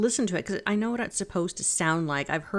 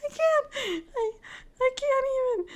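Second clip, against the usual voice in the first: high laughing cry of "can't"; giggling too hard to finish; laughing helplessly. Real, not acted. Stumped by her own notes, high helpless laughter.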